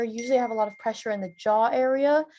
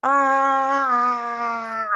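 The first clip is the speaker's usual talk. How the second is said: wide-mouthed roaring "ah"; lion roar for a face warm-up; big open lion roar; 5 dB louder than usual. Shown as a demonstration. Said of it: "make a really big face along with me like this".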